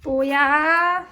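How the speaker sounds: very slow, stretched teasing call; drawn-out teasing. Real, not acted. Owner teasing her dog during fetch, stretched-out call. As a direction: drag every syllable out to tease the dog.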